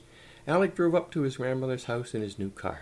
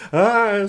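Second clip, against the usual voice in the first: put-on high, very loud old lady's scream; terrified scream; 10 semitones higher, 15 dB louder than usual. A narrator's reading. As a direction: shriek in sudden fright. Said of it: "screamed Grandma".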